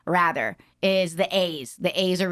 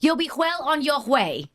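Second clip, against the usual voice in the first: higher, sing-out mock English accent; poor English accent; 6 semitones higher than usual. Shown as a demonstration. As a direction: put on a shaky attempt at English. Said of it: "That wasn't a good English accent".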